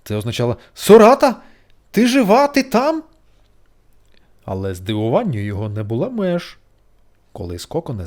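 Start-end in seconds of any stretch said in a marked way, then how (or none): 0.7-3.0 very high, loud calling; anxious calling. A narrator's reading. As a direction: call out for her anxiously. Beetle calling into cocoon. (жук гукає Сорату)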